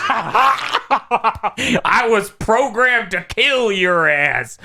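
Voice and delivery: hammed-up Southern threat; Southern trucker accent. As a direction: stretch it out as a cartoonish Southern trucker. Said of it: "this Southern trucker android".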